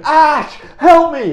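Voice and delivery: high, loud pleading call; mock cry for help. Pikachu calling to Ash the entertainer, loud plea. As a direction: yell for rescue, half comic.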